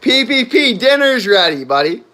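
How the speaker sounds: calling out loud to the pet; yelling across the room. Real, not acted. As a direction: call him from the next room.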